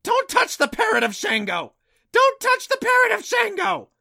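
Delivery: very high, loud screeching parrot voice; piercing bird shriek, far above normal pitch; shrill parrot squawk. A narrator's reading. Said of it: "the same voice blurted out".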